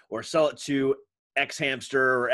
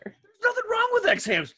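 defensive. Real, not acted. Defending himself, high far voice.

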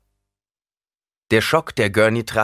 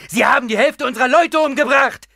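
much higher, louder furious accusation; angry shout; 8 dB louder than usual. A narrator's reading. The soldier shouts furiously about the dead. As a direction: yell the accusation at him.

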